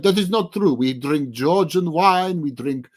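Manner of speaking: mock a Russian woman protesting in a bad Russian accent; awful Russian accent. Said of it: "I'm doing this most awful Russian accent".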